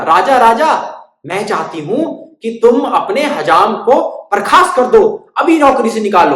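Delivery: loud, high insistent bird voice; demanding little bird. Acted. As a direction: call the king and demand it. Bird demanding king fire barber.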